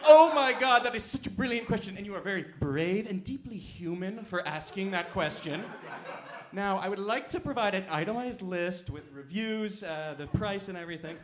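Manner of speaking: loud, high, overeager flattering assistant; butter up the user like a fawning AI; gushing chatbot. Retold or imitated. ChatGPT flattering grandma over her question, loud gushing voice. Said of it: "It'd be like".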